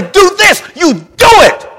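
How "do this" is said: high, loud drill order; barked command. Retold or imitated. Drill sergeant commanding recruits, high loud command. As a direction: snap it out like a sergeant. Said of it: "when I say".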